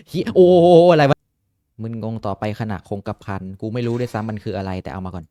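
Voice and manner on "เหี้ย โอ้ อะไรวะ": sudden loud, high startled cry; startled exclamation. Real, not acted. Gamer startled mid-game, loud high startled exclamation. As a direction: blurt it out loud in real shock.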